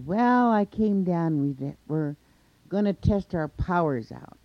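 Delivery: shouted explanation across a distance; calling down a challenge. A narrator's reading. Coyote calls his challenge down.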